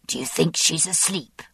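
ordinary delivery

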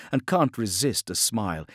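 plain reading voice

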